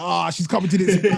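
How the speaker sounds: imitating an excited friend; teasing put-on voice; mocking friend. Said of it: "You were like".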